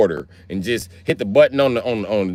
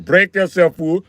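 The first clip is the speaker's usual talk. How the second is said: raised, louder mock Indian accent; 6 semitones higher, 5 dB louder, slower than usual. Robber's stickup demand in mock Indian accent.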